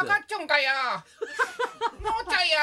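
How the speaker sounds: high-pitched, loud scary PE teacher; 北村先生. Acted. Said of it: "梶原一騎さんによく似てる怖い体育の先生". (怖い体育教師の甲高い怒鳴り声)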